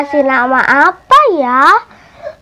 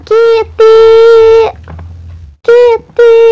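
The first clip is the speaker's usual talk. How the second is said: loud, high calls for the cat; calling out; 5 semitones higher, 3 dB louder, slower than usual. Acted. Girls searching for the lost cat, calling out loud. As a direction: call across the yard for her kitten.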